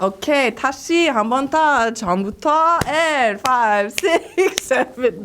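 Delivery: high, projected class count-in; dance teacher. Retold or imitated. Dance teacher cueing her class to restart, high calling count. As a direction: call the class back to the top. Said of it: "when I'm explaining stuff".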